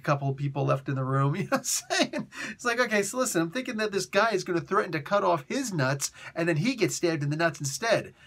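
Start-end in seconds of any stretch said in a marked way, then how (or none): none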